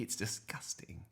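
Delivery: disgusted whisper. Acted. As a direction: breathe it out in disgust. Susie disgusted aside.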